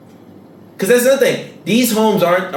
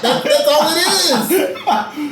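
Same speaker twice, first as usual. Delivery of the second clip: laughing; 11 semitones higher than usual. Real, not acted. Laughing while making his point.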